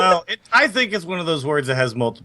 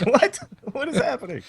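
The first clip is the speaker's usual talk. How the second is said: much higher, baffled squeak; mock bewildered; 12 semitones higher than usual. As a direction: play the stunned kid who can't follow. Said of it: "You're like".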